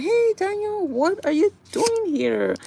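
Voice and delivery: high, drawn-out sing-song greeting; mock confusion. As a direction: imitate a friend confronting him, high and stretched out. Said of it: "Like, all confused and stuff".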